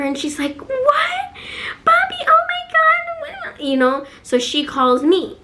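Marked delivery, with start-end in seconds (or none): 0.6-3.6 very high mock squeal of disbelief; shocked little sister. Little sister hearing dad's sob story, high-pitched mock shock. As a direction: imitate a kid's horrified shock, pitched way up.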